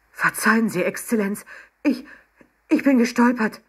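high, loud, stammering excuse; flustered apology. A narrator's reading. Red-faced waiter begs the sheikh's pardon, flustered. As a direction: apologize in a panic.

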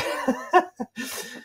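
high giggle at his own joke; a quick amused laugh. Real, not acted.

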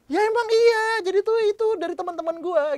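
very high, loud insistence on "emang iya"; penekanan kuat. Shown as a demonstration. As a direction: belt "emang iya" and "teman-teman gua" emphatically. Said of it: "Gua pancing lagi". (tekankan "emang iya" keras dan tinggi)

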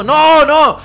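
high, loud imitated cries of refusal; mock-panicked shout; vehement mother. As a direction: blurt it out like an alarmed mum. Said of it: "mi mamá así muy vehemente".

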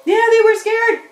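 ordinary talk

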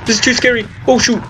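usual speaking voice

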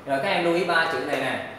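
ordinary delivery

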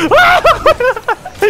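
shrieking sorry; screaming. Real, not acted. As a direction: scream it out, high and loud. Jump scare in game.

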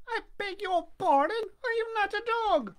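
put-on high, squeaky wooden-boy voice; high puppet voice. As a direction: pipe it up like a puppet.

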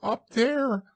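high, squeaky little voice; frightened Piglet. A narrator's reading. Piglet points Christopher Robin to the trap, high and frightened. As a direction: squeak it as a scared small creature. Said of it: "said Piglet".

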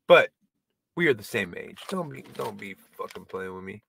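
normal talking voice

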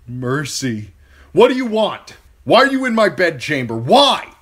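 high, loud frightened questions; panicked demand. A narrator's reading. Theon in his bedchamber, panicked shouting at Reek. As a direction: shout in confused fear. Woken in terror, demands answers.

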